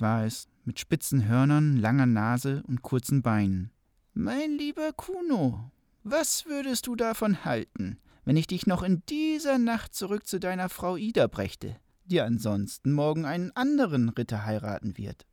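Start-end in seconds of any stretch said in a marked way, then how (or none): 4.1-15.3 smooth polished devil. Acted. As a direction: sweet-talk him like a sly devil. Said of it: "mit des Teufels Stimme".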